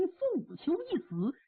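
ordinary delivery, nothing marked

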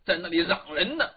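ordinary delivery

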